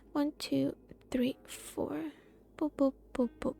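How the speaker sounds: ordinary talk, nothing marked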